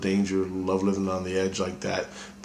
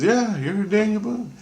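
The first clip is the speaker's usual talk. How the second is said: much higher revelation voice, imitated; Christ's voice; 13 semitones higher than usual. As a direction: announce the past life from way up high. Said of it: "And he goes".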